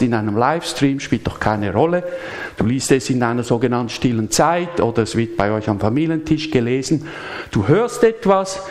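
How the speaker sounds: usual speaking voice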